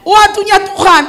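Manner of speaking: high and loud declaration; preacher declaring God's timing loudly to the church; loud proclamation. Real, not acted. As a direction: proclaim it firmly to the congregation. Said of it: "betul nggak".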